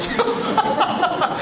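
a real burst of high laughter. Real, not acted. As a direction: laugh out loud at the memory. Laughing at his own story.

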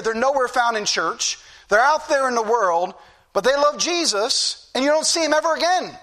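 normal talking voice

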